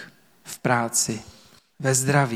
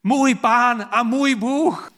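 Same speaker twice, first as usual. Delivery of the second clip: "Můj Pán a můj Bůh" cried out much higher and slow, stressing "můj"; exclaimed cry; 9 semitones higher, slower than usual. Acted. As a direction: call out "Můj Pán a můj Bůh" in awe, high and drawn out. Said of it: "Zvolal, čteme".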